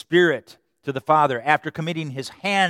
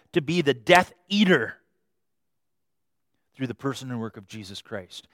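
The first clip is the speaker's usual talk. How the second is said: ordinary talk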